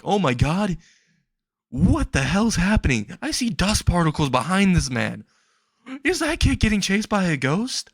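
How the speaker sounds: high-pitched mock disbelief; gasping bystander, played up; astonished onlooker. Crowd member reacting to the chase.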